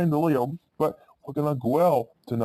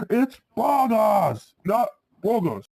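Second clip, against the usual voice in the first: angry; 7 semitones higher, slower than usual. Acted. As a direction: snap the correction at grandma, really annoyed.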